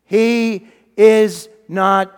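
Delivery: loud, very slow, word by word; emphatic proclamation. Acted. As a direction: proclaim it slowly and loudly, spacing each word. Angel announces the resurrection.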